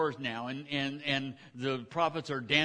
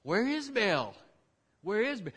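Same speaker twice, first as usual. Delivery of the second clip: high, loud, anxious calling; frantic prophets; 9 semitones higher, 6 dB louder than usual. Acted. Prophets of Baal searching for their god, high frantic calls. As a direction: panic as the god fails to show. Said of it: "they're going".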